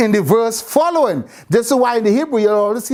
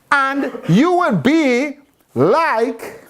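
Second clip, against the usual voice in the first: drawn-out reading of "And you will be Like"; slow reading; 3 semitones higher, much slower than usual. Shown as a demonstration. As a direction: stretch every word of the verse. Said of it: "And so now he's saying".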